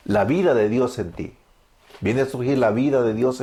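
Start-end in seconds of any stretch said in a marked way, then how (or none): none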